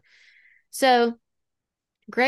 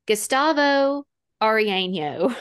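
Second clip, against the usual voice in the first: Spanish name slowly, in an American accent; careful try at a Spanish name, American accent showing; non-native Spanish accent; slower than usual. Real, not acted. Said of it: "but I have this accent".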